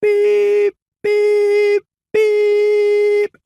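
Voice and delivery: high loud drawn-out beeps; high whistle beeps; engine whistle beeps. A narrator's reading. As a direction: toot like a little engine whistle. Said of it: "whistled Thomas".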